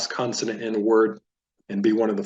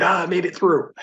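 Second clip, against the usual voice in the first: higher-pitched cry of playful relief; mock celebration; 7 semitones higher than usual. Teacher voicing a student finishing the drill, mock celebration. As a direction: celebrate getting to the end, playfully. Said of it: "so you could celebrate".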